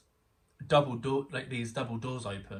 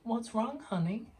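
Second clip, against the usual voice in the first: much higher sweet voice of a caring backing singer; backing singer soothing an upset child, high and sweet; kind backing singer; 12 semitones higher than usual. Retold or imitated. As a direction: coo it like a gentle grown-up to a child. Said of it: "called me over and was like".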